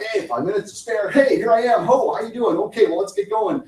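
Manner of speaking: a frazzled latecomer bursting in; flustered rush. Retold or imitated. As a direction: burst in late and scattered. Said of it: "kind of flying in like".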